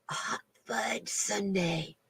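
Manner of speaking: a slow dreamy whisper of the dessert's name; hushed and slow, savouring every word; whispered longing. Real, not acted. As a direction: breathe it like a secret craving.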